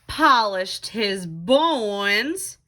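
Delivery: normal talking voice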